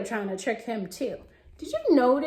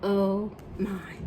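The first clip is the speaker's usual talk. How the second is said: usual speaking voice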